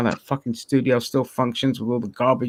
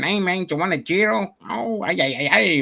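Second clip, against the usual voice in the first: put-on higher-pitched caricature Mexican accent; mock Mexican accent; 7 semitones higher than usual. Joking that Greeks don't sound like this, mock Mexican accent. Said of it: "not Mexicans".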